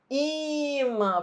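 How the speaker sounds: IMÃ called out with a long, high I; drawn-out first syllable. Shown as a demonstration. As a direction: hold the I of Imã to find the strong syllable. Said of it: "ficar repetindo". (Imã com a primeira sílaba esticada e aguda)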